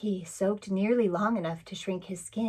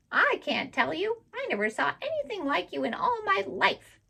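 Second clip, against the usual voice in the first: mocking parrot; 10 semitones higher than usual. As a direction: squawk out the insults like a saucy bird. Parrot replies.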